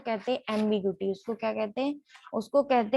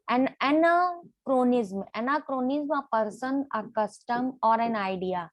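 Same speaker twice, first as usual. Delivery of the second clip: slow dictation; much slower than usual. Shown as a demonstration. Teacher dictating a definition.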